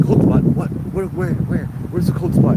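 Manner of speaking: excited retold question. Tourist excited about cold spot.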